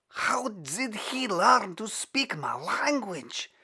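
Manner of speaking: put-on slow, wondering line in an Egyptian accent; puzzled man, Egyptian accent. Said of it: "an Egyptian accent".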